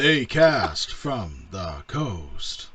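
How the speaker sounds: slow, drawn-out robotic monotone; slow mock computer voice. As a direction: spell it out like an old computer. Asked to do computer voice.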